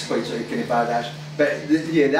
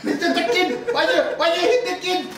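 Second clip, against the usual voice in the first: much higher, hammed-up South African gangster accent; mock South African gangster. Friend mocking the Chappie gangsters in a high South African accent. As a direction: ham up a South African thug, squeaky and cocky. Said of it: "that accent".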